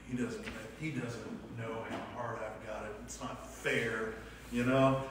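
a hint of a sulking envious believer; self-pitying grumble.

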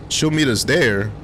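usual speaking voice

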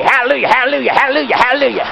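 mock Charlie Mahaney. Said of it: "Sound like Charlie Mahaney".

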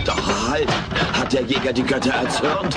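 stalk the fridge like a hunter on camera; nature documentary narration. Acted. Alf narrates his own fridge raid as documentary.

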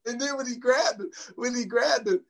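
ordinary talk, nothing marked

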